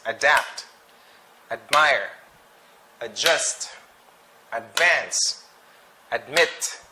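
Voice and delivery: adapt, admire, adjust, advance, admit, each stressed late; weight on DAPT, MIRE, JUST, VANCE, MIT; stress on second syllable. Shown as a demonstration. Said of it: "the stress, uh, usually is in the second".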